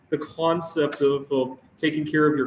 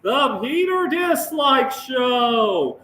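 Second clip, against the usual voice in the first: show intro shout; 8 semitones higher, much slower than usual. Pretend. Host announcing his own show, slow booming shout. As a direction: belt out the show title like a hype announcer.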